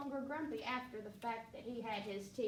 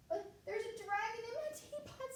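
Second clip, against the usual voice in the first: high pleading cook; 10 semitones higher than usual. Acted.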